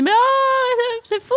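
mock outrage, voice jumping up high; outrage imité. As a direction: ham up a shocked old pundit. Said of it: "Et donc, il dit". (indignation moqueuse, voix plus aiguë)

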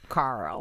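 low "Carl" with a heavy R; low, exaggerated R in "Carl"; hard R. Shown as a demonstration. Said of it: "Not with the hard R".

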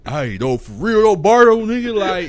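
hammed-up West Coast rapper greeting. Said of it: "he talk like this".

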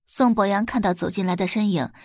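ordinary delivery

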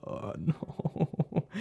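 soft, breathy long "oh no"; dismayed groan. Retold or imitated. Viewer reacting to the heartbreaking scene, hushed dismay. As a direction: let out a hushed, drawn-out oh no.

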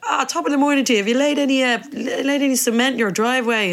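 mock stage Irish. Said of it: "people would come in and be like".

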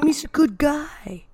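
whispered, mocking "Jamie's a good guy". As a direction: hiss it back sarcastically. Mocking Martin's claim.

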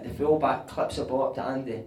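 normal talking voice

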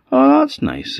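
very high-pitched "oh, that's nice"; pleased son. Acted. Son reacts to the wee goat for mum, high and pleased. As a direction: coo it high in approval.

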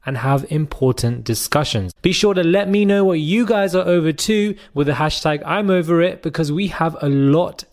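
upbeat presenter read, slowed and stretched out; intro host voice. Acted. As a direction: announce the show like a polished host.

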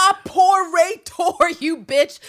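very high, slow, loud enunciation; over-enunciated angry. Retold or imitated. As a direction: drag out each syllable in rage. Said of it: "enunciating in ways that don't make sense".

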